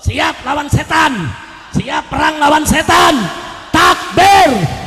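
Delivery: high, loud crowd-rousing shout; rallying battle cry. Real, not acted. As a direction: shout the call to arms at full force. Rallies crowd, calls takbir.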